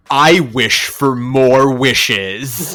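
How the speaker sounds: very slow, sly genie wish. Retold or imitated. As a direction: spell each word out like a sly wish. Wishing to the genie.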